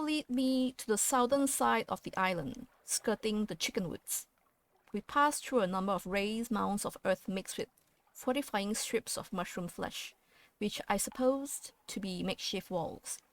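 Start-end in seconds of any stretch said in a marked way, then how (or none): none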